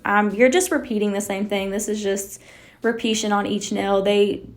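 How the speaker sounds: ordinary talk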